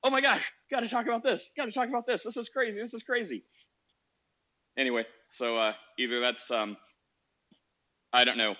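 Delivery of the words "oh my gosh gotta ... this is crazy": high, hyped-up gushing; imitating excited self. Host imitating himself hyped before recording, high excited gushing. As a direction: gush it way up high like you can't wait to share. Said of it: "And I'm just like".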